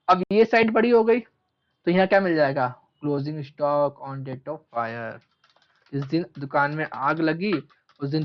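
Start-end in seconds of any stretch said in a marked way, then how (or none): 3.0-5.2 drawn-out words, low pitch; slow dictation. Real, not acted. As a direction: spell it out slowly for note-takers. Dictates while writing it out.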